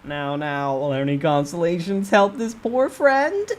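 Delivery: squeaky merchant sprite teasing the player. Acted. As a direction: sell it like a sly carnival barker. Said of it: "the Doc Aebi's merchant-like voice".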